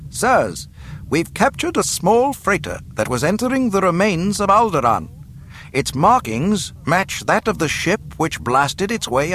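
high-pitched officer's report; reporting over speaker. A narrator's reading. Officer reporting a captured freighter, high formal announcement. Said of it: "a voice reported over an unseen speaker".

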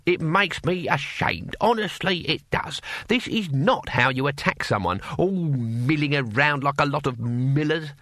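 put-on old warrior, scornful lecturing. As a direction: scold them like a disappointed old instructor. Cohen lectures the guards while fighting.

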